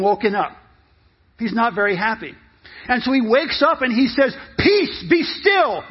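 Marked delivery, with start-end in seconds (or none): 4.6-5.8 high, slow, forceful command; commanding shout. Acted. Jesus wakes up and orders the storm to stop, commanding shout. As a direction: call out over the storm.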